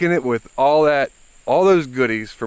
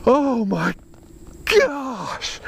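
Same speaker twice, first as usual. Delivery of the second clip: high, cracking cry of joy; real tearful elation; overjoyed cry; 11 semitones higher, slower than usual. Real, not acted. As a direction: voice breaking with happy tears. Weighing record fish.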